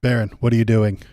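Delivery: loud, firm demand near normal pitch; loud reprimand from a disapproving dad; stern father voice. Acted. Trump catching his son kissing, loud stern father. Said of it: "so I know what, uh, voice to do".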